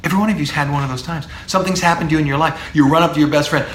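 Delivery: normal talking voice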